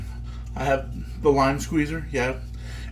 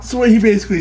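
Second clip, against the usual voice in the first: laughing; 8 semitones higher, 8 dB louder, much faster than usual. Real, not acted.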